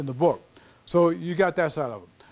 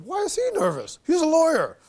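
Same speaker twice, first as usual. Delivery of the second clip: high-pitched mock incredulity; comic bafflement; 12 semitones higher than usual. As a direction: exclaim in exaggerated disbelief, voice shooting up. Retold baffled reaction to nervous lawyer.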